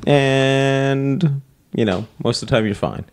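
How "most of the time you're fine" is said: normal talking voice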